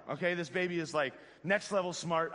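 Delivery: usual speaking voice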